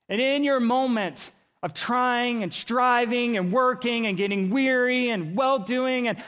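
high, loud, driving sermon delivery; impassioned preaching. Real, not acted. Preacher urging congregation to keep calling on Jesus, impassioned. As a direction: preach it with urgent force.

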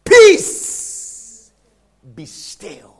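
loud on peace, then a hushed still; Jesus rebuking storm. Acted. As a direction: command the storm, then hush it. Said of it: "He said what?".

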